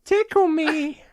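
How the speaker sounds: high squeaky puppet voice; Elmo voice. Acted. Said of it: "We did Tickle Me Elmo".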